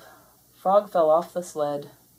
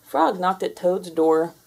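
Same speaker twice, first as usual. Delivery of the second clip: plain reading voice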